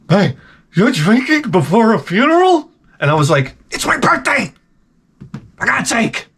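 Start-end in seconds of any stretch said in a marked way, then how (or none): 0.1-2.7 imitating a wife's high-pitched disbelief; scolding wife. Wife catching husband drinking before funeral, high and scandalized. 3.7-6.3 high-pitched, strained comic outburst; exasperated protest. Retold or imitated. Husband defending his drink to wife, high exasperation.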